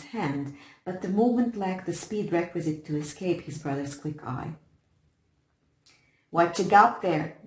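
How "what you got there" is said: put-on rough demanding boy, louder; a tough kid's demand. A narrator's reading. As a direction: bark it at your brother. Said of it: "demanded Midge".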